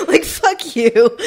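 slow, loud laughing mock insult. Real, not acted. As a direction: laugh it out in amused outrage. Laughing at being burned by seller.

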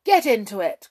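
a loud sharp command. A narrator's reading. Hop commanding Skip into the basket, loud. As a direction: order him in, no question. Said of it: "commanded Hop".

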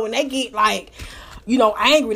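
ordinary delivery

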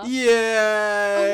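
loud excited cheer. Real, not acted. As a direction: cheer like a crowd. Intro cheer.

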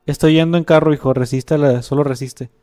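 dad racing to his son, loud and urgent; dramatic story reading. Acted. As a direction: push the father's rush to save his son. Reading chat story aloud.

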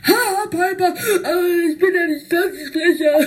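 high, loud laughing taunt; taunting character voice. Acted. Spike teasing Piper, high laughing taunt. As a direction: tease with a giggle.

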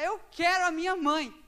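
loud mock cry for his mother; half-joking yell like a scared child; childish wail. Preacher mocking himself wanting his mother on the bus. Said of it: "Só faltou gritar".

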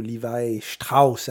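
STRAUSS said the German way, as "Shtrauss"; German accent. Shown as a demonstration. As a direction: put a German accent on Levi Strauss, with a "sht" in Strauss. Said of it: "avec un accent allemand". (accent allemand)

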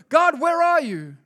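high, accusing plea upward; whiny blaming cry. Retold or imitated. Believer blaming God, high whiny plea. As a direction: play the complaining believer calling on God.

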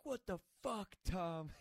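high, strained mock-angry outburst; imitating pissed-off friend. Friend confronting him over the makeout, imitated anger. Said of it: "He's all pissed off at me".

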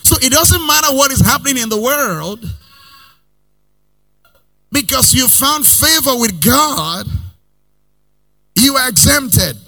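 high, loud rising preacher cadence; preaching at full force. Acted. Preacher assuring the congregation they are exempted, full force. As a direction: build it up like a revival preacher.

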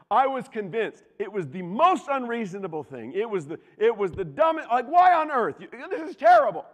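loud, high, hammed-up indignation, building; heated outrage. Teenage son protesting his father's refusal, heated. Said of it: "I'm heated".

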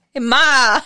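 put-on a quick Brooklyn-accented call to mom; a short Brooklyn "Hey, Ma". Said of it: "give me your best Brooklyn accent".

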